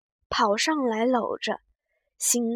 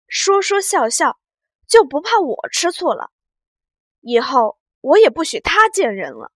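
blustering rant; 2 semitones higher, 9 dB louder than usual. Acted. Husband ranting about jealous wife.

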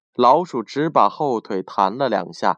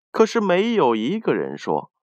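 ordinary delivery

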